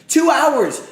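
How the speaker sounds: loud, high cry of disbelief; exasperated yell. Real, not acted. Streamer yelling about waiting for his pick. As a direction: yell it at the broadcast. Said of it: "I'm annoyed".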